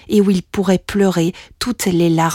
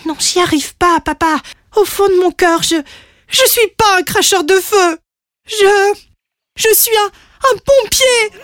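high voice, building to an outburst; distressed little dragon; 11 semitones higher than usual. Acted. Young dragon confesses to his father, pleading then crying out. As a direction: blurt the confession in rising, broken bursts.

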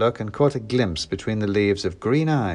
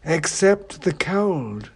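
snooty bored cat; 8 semitones higher, much slower than usual. A narrator's reading. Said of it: "said the cat from the shadows".

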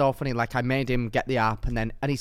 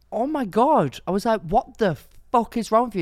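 mock outraged yell; 10 semitones higher, 4 dB louder than usual. Retelling her shocked reaction.

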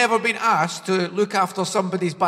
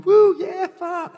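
high whooping mock cheer; imitated crowd cheer; 12 semitones higher, 4 dB louder, slower than usual. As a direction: whoop like an excited American audience member. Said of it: "I heard people going".

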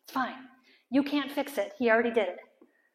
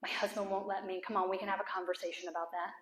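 ordinary delivery

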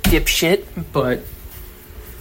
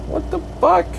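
high squeaky disbelief. Real, not acted. Confused at the bill.

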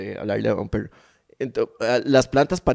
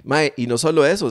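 ordinary talk, nothing marked

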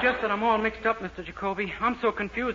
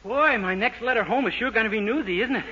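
ordinary talk, nothing marked

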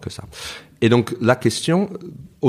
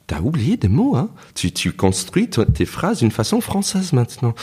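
higher, mock-teasing American friend; 5 semitones higher than usual. American friends visiting tease him, mock-teasing. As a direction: rib him about his French-sounding English. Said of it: "ils disent".

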